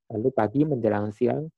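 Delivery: ordinary talk, nothing marked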